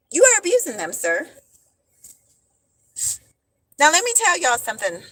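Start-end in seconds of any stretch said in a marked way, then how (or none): 0.1-1.3 high, loud emphasis; calling out an abusive man, high and loud; emphatic. Real, not acted. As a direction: hammer the accusation home. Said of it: "preaching at an abusive man".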